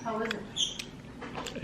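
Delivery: unvoiced whispered question; whispering. Real, not acted. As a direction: whisper so the mic barely catches it. Asks quietly while filming.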